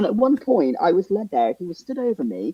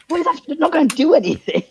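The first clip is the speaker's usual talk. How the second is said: high, indignant what is that; fed-up patient protesting; incredulous outburst at the dentist; exasperated disbelief; 12 semitones higher, 5 dB louder than usual. Retold or imitated. Outraged at second antibiotics.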